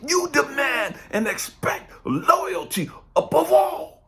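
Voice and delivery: high, loud, furious rant; shouting down the hypocrisy; God ranting. Retold or imitated. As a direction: lay into him like an angry God. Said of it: "what God had to remind me is".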